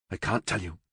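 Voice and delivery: a touch of wretchedness, said quietly; wretched. Acted. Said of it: "he said wretchedly".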